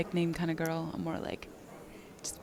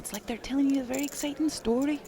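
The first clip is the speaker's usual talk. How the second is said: high, excited storytelling in mock-Scottish; sing-songy Scottish accent; 7 semitones higher than usual. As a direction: lilt upward like a Scottish storyteller. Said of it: "they're very sing-songy".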